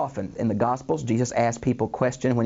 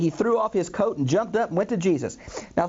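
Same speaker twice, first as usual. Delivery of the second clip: ordinary delivery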